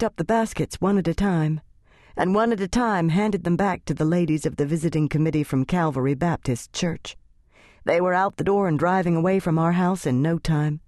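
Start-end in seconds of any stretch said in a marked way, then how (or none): none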